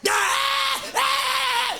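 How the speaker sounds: very high imitated child shriek; mock hyper scream. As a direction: shriek like an overexcited kid. Hyperactive eleven-year-old shrieking.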